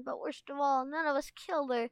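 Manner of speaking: playful exaggerated dismay; mock anguish. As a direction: mourn it theatrically, as in play-acting. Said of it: "let out a cry of anguish".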